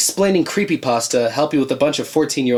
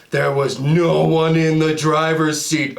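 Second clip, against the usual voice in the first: ordinary talk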